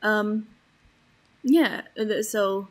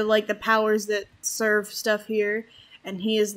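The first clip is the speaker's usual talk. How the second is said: ordinary talk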